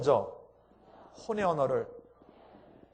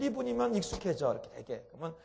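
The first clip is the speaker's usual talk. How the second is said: ordinary delivery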